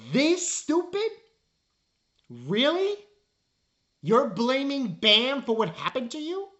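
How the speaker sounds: high, loud mocking disbelief; incredulous. Real, not acted. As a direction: scoff at the claim in disbelief. Incredulous reaction to villain.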